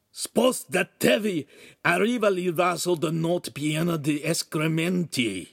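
high, rough Italian yell; rasping shout. Acted. Lout warning passersby about the chamber pot, rasping shout. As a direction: bellow a warning down the street. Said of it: "he shouted".

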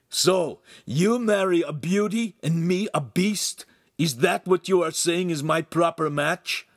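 high, indignant challenge; jealous outrage; bitter accusation. A narrator's reading. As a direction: demand an answer with wounded fury. Jealous man confronts his rival.

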